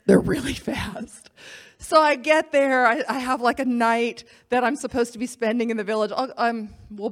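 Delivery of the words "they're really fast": hushed, slow whisper; spooky slow whisper. Real, not acted. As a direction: whisper it like a creepy secret.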